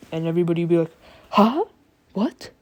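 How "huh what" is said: high rising, startled question; baffled. Retold or imitated. Classmates stunned by the sleeper's math answer, baffled. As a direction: blurt it out in disbelief. Everybody reacts to Deku's answer.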